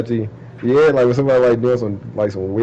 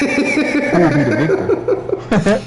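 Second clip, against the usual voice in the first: mock announcer, laughing; 4 semitones higher, much slower than usual. Mock dramatic question while laughing.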